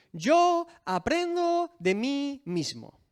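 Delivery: mock the arrogant claim, drawn out; arrogant mock quote. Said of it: "la frase más arrogante y absurda". (cita burlona y arrogante, lenta)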